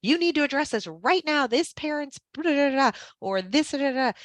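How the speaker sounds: high-pitched, urgent mock panic; frantic stressed colleague. Teacher mimicking a panicked staff member, high and urgent. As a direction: rattle it off like everything's an emergency. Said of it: "they might think it's an emergency".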